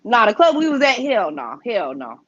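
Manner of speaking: ordinary talk, nothing marked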